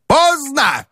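high shouted Поздно; shouting. Acted. Strukov shouts Поздно at Ivan Ilyich, high falling shout. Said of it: "закричал Струков".

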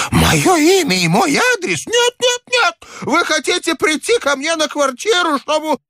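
put-on hysterical high-pitched old man; very high, frantic old man's outburst; crying out. A narrator's reading. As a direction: shriek it in panicked suspicion. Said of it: "Старик так и закричал".

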